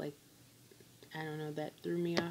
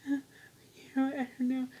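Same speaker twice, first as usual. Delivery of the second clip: high, loud, lost little voice; a mock weepy don't-know, pitched up; dazed and tearful; 8 semitones higher, 7 dB louder, faster than usual. Patient answers surgeon after surgery, mock tearful and dazed.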